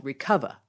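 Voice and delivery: "Recover" said ree-KUV-uh; "Recover" with its final r dropped. Shown as a demonstration. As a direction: end "Recover" on an open uh, no r. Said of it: "this is not my New York accent".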